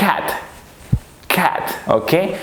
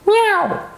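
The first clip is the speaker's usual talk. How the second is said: high, long cat meow. Pretend. Teacher imitating a cat for pupils, cat meow. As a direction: mew like a cat.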